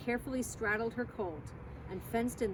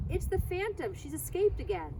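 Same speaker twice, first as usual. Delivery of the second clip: crowd cry; 8 semitones higher than usual. A narrator's reading. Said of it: "the people took up the cry".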